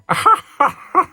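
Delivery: high, loud imitated cackle; Alfred Hayes. Host imitating the commentator cackling in the background, mock cackle. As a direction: cackle in staccato, high-pitched bursts. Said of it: "who's just cackling in the background".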